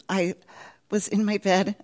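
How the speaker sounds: ordinary delivery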